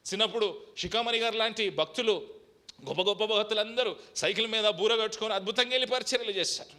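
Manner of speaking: loud, high challenging sermon; preaching at full force. Acted. Pastor challenging the church why it stands still, loud fiery preaching. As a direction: press the people hard from the pulpit.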